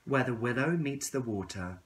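ordinary delivery, nothing marked

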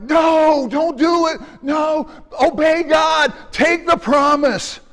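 preacher yelling at his Bible, slow and high. Retold or imitated. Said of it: "I yell at my Bible".

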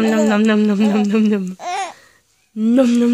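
loud, playful chomping noises; munch the toes loudly and steadily; nom nom chomping. Pretend. Parent chomping on baby's toes.

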